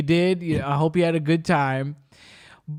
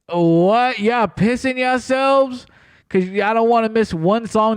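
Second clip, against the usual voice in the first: loud, slow disbelieving outburst; can't believe what she hears; incredulous; 6 semitones higher, 4 dB louder than usual. Real, not acted. Ranting to co-host about diaper-wearing fans, loud incredulous. Outraged at concertgoers.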